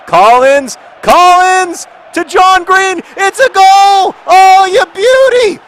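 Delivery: very high, loud shouting; roaring play-by-play at fever pitch; ecstatic goal call. Real, not acted. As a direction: scream the goal, drawing out the names. Calls a goal live.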